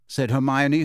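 plain reading voice

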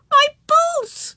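very high, loud wail; wailing child. A narrator's reading. Girl lifted out of her new boots, wailing. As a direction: cry out in dismay like a little girl. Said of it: "she wailed".

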